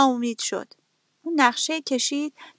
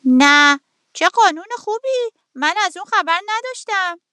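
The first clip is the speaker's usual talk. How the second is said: a rooster pretending to believe the fox; playfully fake-surprised rooster; mock-impressed rooster; 7 semitones higher than usual. A narrator's reading. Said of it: "خروس سری تکون داد و گفت".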